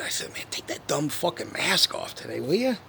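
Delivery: normal talking voice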